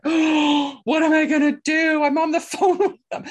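high, loud panicked cry with a laugh at the end; hammed-up alarm at your own mistake; mock panic. Realises she has no visa.

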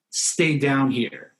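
slow, level, grounded delivery; voice kept down. Shown as a demonstration. Teaching parents to answer a yelling kid, low and steady. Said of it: "just stay as low as you can".

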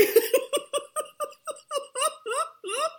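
long high-pitched laugh; laughter. Real, not acted. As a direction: let out a real, sustained giggling laugh. Host cracks up at guest's admission.

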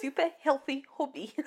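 quick South African accent attempt. Shown as a demonstration. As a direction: try it South African style. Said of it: "Say it in a South African accent".

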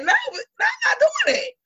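high whiny mock of a clingy woman waiting by the phone; mocking a needy girlfriend. Telling her friends how she refuses to wait by the phone, mocking whine. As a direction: ham up the desperate girlfriend pining by the phone.

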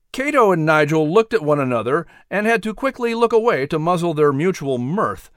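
usual speaking voice

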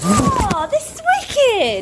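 high, slow delighted gasp; thrilled on first sight, slow and high; excited. Real, not acted. Thrilled on seeing the venue.

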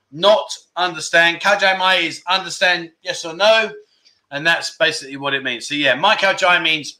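ordinary delivery

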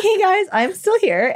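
high, drawn-out 'hey guys'; singsong interjection. Retold or imitated. Dancer interrupting two customers, high singsong reminder.

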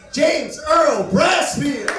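high, slow, shouted name call; stretched hype shout introducing the co-host; announcer intro shout. Real, not acted. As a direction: boom the name out like a ring announcer.

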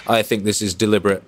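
normal talking voice

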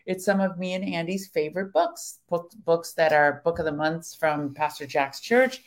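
usual speaking voice

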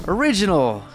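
ordinary delivery

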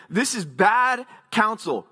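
"This is BAD counsel", slow, leaning on BAD; emphatic declaration. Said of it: "emphatically him declaring".

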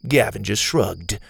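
ordinary delivery, nothing marked

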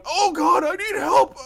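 high, loud hammed-up plea for help; mock pleading. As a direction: beg like a wounded paladin, played for laughs. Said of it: "the paladin's going".

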